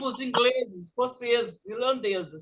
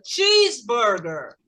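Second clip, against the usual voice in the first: "Cheeseburger" slow, CHEESE strong and raised; stress on first word; slower than usual. Shown as a demonstration. Teacher shows pupils which word is stronger, first-word stress. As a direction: punch CHEESE in "Cheeseburger", drawn out. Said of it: "vai ser mais forte vai ser a primeira".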